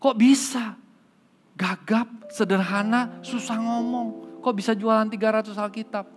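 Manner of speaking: high, amazed disbelief; can't believe what he just heard; astonished pastor. Acted. Pastor stunned that the stutterer sold the most, high astonished voice. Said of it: "Kaget, Pak Pendeta".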